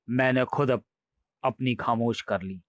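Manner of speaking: usual speaking voice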